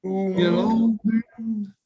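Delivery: slow, drawn-out doomsayer; slow ominous drawl. Acted. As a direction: stretch each word with gloom. Third speaker repeats doom catchphrase.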